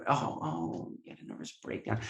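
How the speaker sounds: whispered, pitying oh; mock sympathy whisper. Imitating coworkers' sympathy, whispered softly. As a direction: hush it like consoling someone fragile.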